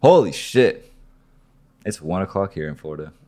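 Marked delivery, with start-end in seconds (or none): 0.0-0.9 loud, high burst of surprise; surprised outburst. Real, not acted. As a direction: blurt it out, caught off guard. Sudden outburst at the late hour.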